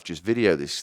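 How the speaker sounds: usual speaking voice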